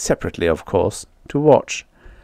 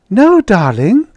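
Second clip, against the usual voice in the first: high, loud, delighted lilt; joyous reply; 12 semitones higher, 7 dB louder, slower than usual. A narrator's reading. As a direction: answer him with glee. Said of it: "Judy replied joyously".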